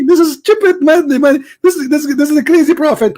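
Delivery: ordinary talk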